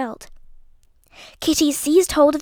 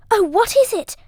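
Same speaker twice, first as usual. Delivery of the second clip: high, loud alarmed cry; frightened cry; 8 semitones higher, 5 dB louder than usual. A narrator's reading. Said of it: "cried Beanie".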